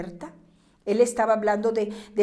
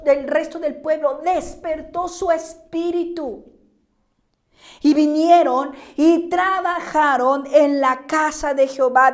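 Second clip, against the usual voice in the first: fiery preaching; 3 semitones higher, 7 dB louder than usual. Acted. Pastor rousing the congregation, preaching at full force. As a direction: lift the voice and preach it to the rafters.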